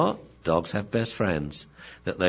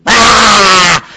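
loud, high full-voiced scream; 14 semitones higher, 15 dB louder than usual. Owner whose dog is being attacked, screaming. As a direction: scream out in alarm. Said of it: "if she had screamed".

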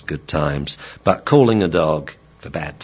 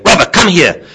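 loud, raised, urgent gruff command; gruff shout; 11 semitones higher, 10 dB louder than usual. Shown as a demonstration. Trainer shouting in training to signal urgency, gruff shout. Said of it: "we use a gruff voice".

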